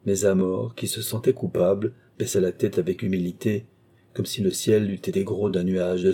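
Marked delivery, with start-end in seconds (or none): none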